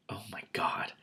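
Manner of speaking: hushed, breathless oh my God; whispered oh my God. Real, not acted. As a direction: whisper it in alarm. Reader fears elf image.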